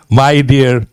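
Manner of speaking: ordinary talk, nothing marked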